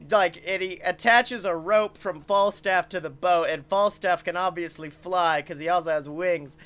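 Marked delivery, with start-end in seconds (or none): none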